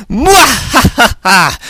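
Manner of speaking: high, slow villain's cackle; evil cackle. A narrator's reading. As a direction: draw out a hammed-up villainess laugh. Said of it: "she cackled".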